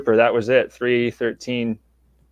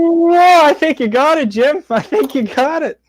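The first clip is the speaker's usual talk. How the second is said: high excited shouting; yelling in disbelief at the finish; 7 dB louder than usual. Real, not acted. Longshot wins, shouting to Jim.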